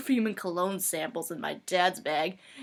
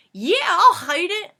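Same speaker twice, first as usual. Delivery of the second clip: loud, high acted confidence; hammed-up cocky friend; emphatic insistence; 10 semitones higher, 12 dB louder than usual. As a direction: belt it out as obvious.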